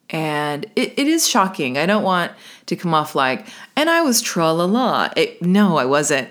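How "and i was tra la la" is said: high, lilting mock-carefree tra-la-la; sing-song show of nonchalance. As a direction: play breezily unbothered, pitched up. Mocking a breezy reaction she denies.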